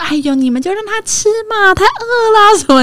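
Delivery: high coaxing granny voice; indulgent grandma. Retold or imitated. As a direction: plead for the grandchild like a doting granny.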